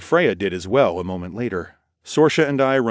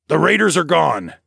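raised, higher declaration; declaring; 8 semitones higher, 5 dB louder than usual. A narrator's reading. Darwin tells the town guards the raiders are gone, declaring. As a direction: announce it firmly and loudly. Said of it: "Darwin declared".

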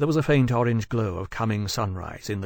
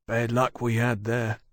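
put-on old sailor. A narrator's reading.

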